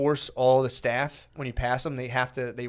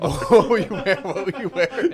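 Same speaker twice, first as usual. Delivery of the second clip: laughing high question; 5 semitones higher than usual. Real, not acted. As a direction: ask through giggles.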